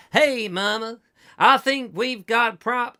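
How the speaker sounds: mock American, carried over from the preacher; put-on American accent. Driver talking to Mama, hammed-up American accent. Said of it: "how I get into the American".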